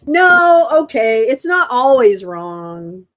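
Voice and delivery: loud outburst, drawn out at the end; loud reaction. Real, not acted. Streamer blurting out a reaction to a claim, loud. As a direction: react loudly, stretching the words. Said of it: "I was just reacting".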